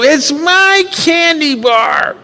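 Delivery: put-on high, slow little girl yelling her claim; a child wailing that it's hers; stubborn child's cry. Girl refuses again.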